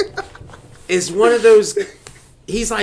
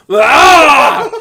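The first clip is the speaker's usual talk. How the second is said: loud, high strangled bellow; mock Arnold yell; 8 semitones higher, 9 dB louder than usual. Fan answering Arnold in kind, hammed-up yell. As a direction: bellow back wordlessly, hammed-up. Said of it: "I'll be like".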